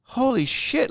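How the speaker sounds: stunned high outburst; shocked exclamation. Retold or imitated. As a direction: blurt it out in shock. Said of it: "And I'm like".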